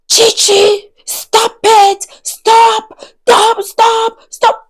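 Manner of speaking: high-pitched mock screams in short bursts; imitated screaming. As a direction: imitate a furious woman screaming. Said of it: "she was literally screaming on top of her voice".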